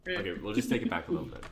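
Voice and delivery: quick hurried aside; rushed restart. Real, not acted. Host hurries to restart the scene, fast. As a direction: rattle it off fast.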